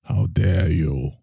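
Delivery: low, slow disdainful 'How dare you?'; Greta's frozen-scowl voice, low and slow; Greta Thimble. Acted. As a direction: drop low and drag it out with disdain.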